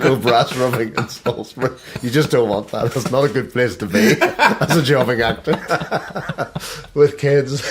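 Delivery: high, loud through laughter; laughing along with the joke. Real, not acted. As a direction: crack up while talking. Running joke, laughing hard.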